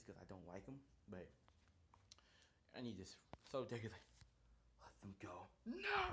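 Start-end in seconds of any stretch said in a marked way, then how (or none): 4.8-5.5 whisper. Acted. Deku's final demand. 5.6-6.1 shout. Acted. Sudden shout.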